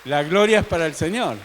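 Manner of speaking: loud, ringing praise; exclaimed glory to God; loud proclamation. Real, not acted. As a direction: proclaim it loudly to the whole congregation. Preacher proclaims praise to the congregation.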